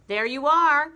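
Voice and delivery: high, loud triumphant shout; husband shouting at wife in chair; shouting Mr Twit. Acted. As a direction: bellow it, pointing. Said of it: "Mister Twit pointed at her and shouted".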